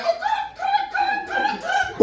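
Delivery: squeaky falsetto good good good; high mock agreement. Passengers nervously agreeing in the back seat, high falsetto. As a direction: chime in with a high strained falsetto. Mocking awkward agreement in car.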